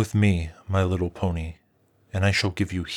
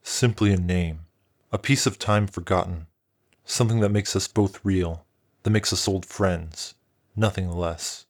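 soft friendly dragon; 5 semitones lower, 3 dB quieter than usual. A narrator's reading. Dragon reassuring the frightened pony, low and gentle. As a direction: reassure her like an old friend. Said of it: "locked into a soft grin".

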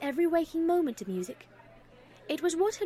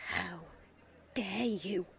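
a low, soft, strained voice; barely audible; 4 semitones lower, 7 dB quieter, slower than usual. A narrator's reading. Daughter stung by her mother's remark, barely audible. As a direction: hold the fury in, softer. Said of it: "in a voice that was barely audible".

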